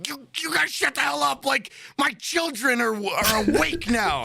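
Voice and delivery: very high, strained mock-furious neighbor; seething. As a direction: imitate an irate parent sputtering in a high voice.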